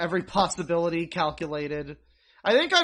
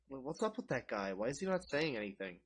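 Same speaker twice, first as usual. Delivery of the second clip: much softer, low murmured aside; muttering table guests; 7 semitones lower, 13 dB quieter than usual. Retold or imitated. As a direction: whisper it like puzzled relatives at dinner. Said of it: "everybody at that table would be like".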